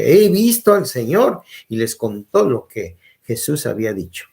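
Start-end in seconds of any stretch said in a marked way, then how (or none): 0.0-1.4 high, loud joyful proclamation; excited announcement. Acted. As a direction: burst out with the good news. Magdalena announces the risen Lord.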